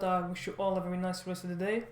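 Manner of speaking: normal talking voice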